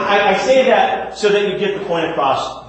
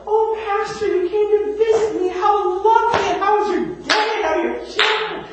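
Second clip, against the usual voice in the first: gushing, much higher voice of a grateful patient; sweet patient voice. Retold or imitated. Patient in bed greets her visiting pastor, sweetly gushing and much higher. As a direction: coo over the visiting pastor like a hospital patient.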